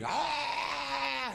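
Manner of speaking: high mock yell; a high shouted "Ah!" imitating Khabib. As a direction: belt out a raw yell like an enraged fighter. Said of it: "Khabib's yelling".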